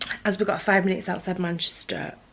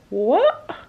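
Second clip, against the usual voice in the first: high, loud startled "What?"; shocked what; 5 semitones higher, 10 dB louder than usual. Retold or imitated. Answering her tram friend's odd remark, surprised. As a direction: bark it out, caught off guard.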